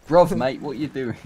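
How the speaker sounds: mock British accent, loud on bruv; cockney-style bruv mate. Playing a Brit for his friend, British accent. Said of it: "you're a Brit".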